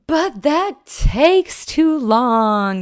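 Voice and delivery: loud, high, drawn-out whine; whining toddler. Retold or imitated. Toddler arguing with mom over food, loud slow whine. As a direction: protest like a whiny little kid, dragging it out. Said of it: "you know this voice".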